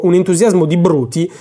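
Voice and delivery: ordinary delivery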